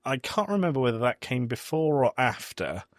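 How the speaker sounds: normal talking voice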